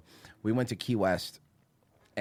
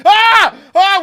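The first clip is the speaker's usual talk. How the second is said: high, loud panicked yelling; retold panicked scream; 16 dB louder than usual. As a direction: scream like you just got ambushed. Terrified scream retold in tiny bar.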